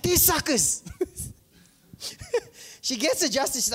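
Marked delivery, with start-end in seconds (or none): 0.0-0.8 gloating sister. Retold or imitated. Sister gloating over her old boss.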